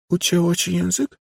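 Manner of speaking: put-on high, stifled child voice; oddly muffled little girl. A narrator's reading. Ghostly girl taunts the speechless woman, high muffled voice. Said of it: "zapytała dziewczynka dziwnie stłumionym głosem".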